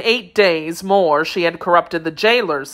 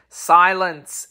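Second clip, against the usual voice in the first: ordinary delivery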